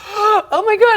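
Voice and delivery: high, loud gasp of alarm; gasp, then a high startled cry; retold panic. Retold fright while being chased.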